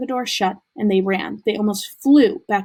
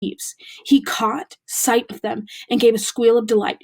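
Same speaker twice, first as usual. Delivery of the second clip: ordinary delivery, nothing marked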